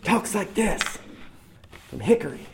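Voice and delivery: a high, thick Southern drawl from Hickory, North Carolina; quick mock Southern voice from North Carolina; southern drawl impression. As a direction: imitate your friend's heavy Southern accent. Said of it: "the most southern voice I've ever heard".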